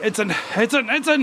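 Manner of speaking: commentator call building to a high yell; excited play-by-play yell; mock commentator build. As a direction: hype the name like a match commentator, climbing in pitch. Mock commentator calling the name.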